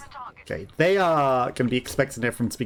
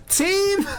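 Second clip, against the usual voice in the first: high, rising call for the team; mock desperate call for help; mock plea. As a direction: whine for your missing team, pitch way up. Calling for absent teammates.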